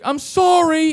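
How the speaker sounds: loud, high-pitched mock apology; mimicked wailed apology. Woman apologizes to her boyfriend, mimicked loud wail. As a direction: cry it out high in her imitated voice.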